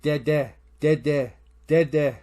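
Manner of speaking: loud, repeated little calls, lightly imitated; a hint of an insistent child; kid calling Dad. Kid summoning dad to the tent, calling. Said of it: "He's like".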